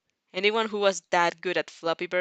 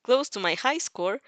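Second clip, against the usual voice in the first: proud boast; 6 semitones higher, 3 dB louder than usual. Acted. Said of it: "he proudly boasted".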